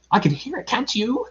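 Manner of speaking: high, nervous little voice; anxious Piglet. A narrator's reading. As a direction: ask in a squeaky worried voice. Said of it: "said Piglet anxiously".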